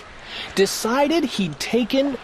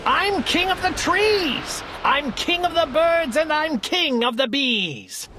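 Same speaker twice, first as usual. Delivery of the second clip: Yertle's shout; 6 semitones higher than usual. Acted. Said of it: "shouted Yertle".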